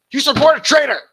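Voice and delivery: over-the-top mocking outburst; mock yell. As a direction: bellow it like a hammed-up accusation. Mock shouting the clip's accusation.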